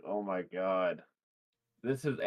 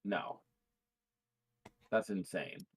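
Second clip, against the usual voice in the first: ordinary delivery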